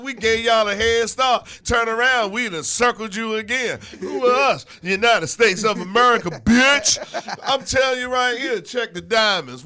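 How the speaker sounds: loud, high, cocky rapper trash talk; brash rapper America. Acted. America the rapper brags at other countries, loud and cocky.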